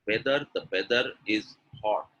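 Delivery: "weather" as "beather"; both lips touch to start "whether"; b-for-v slip in "weather"; wrong B sound. Shown as a demonstration. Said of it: "my two lips are touching".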